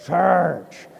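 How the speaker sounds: slow, deliberate imitation of a big Russian deacon; slow mock Russian deacon instruction; Russian deacon's whisper. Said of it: "the deacon whispered to him".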